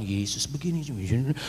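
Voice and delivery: low, hushed evangelizing, held back; speaking softly. Retold or imitated. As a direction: keep it down as if others could overhear. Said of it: "Saya udah ngomong pelan-pelan". (pelan-pelan dengan suara rendah)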